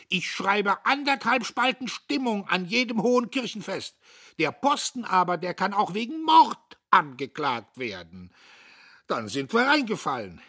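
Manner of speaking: protest, offended; indignant. Acted. The editor defends himself to the men: indignant protest. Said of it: "Der Redakteur entrüstete sich".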